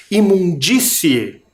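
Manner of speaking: "Imundície" slowly, with a strong, long DÍ; strong stressed syllable. Shown as a demonstration. Teacher showing sound coming strongly from the mouth, stressed "Imundície". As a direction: push the DÍ of "Imundície" out through the mouth. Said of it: "parece que sai forte pela boca".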